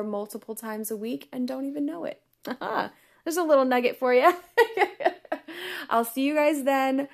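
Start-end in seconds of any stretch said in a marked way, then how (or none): 4.4-5.8 short high laugh; real laugh. Real, not acted. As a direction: chuckle high, pleased with yourself. Laughs after her nugget line.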